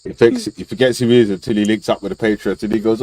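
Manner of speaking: ordinary delivery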